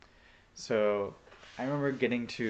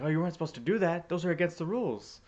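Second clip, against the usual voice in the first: high, fast, raised mock warning; imitated scolding; 9 semitones higher, 4 dB louder, faster than usual. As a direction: mimic a flustered man telling you off. Acts out David's alarmed scolding.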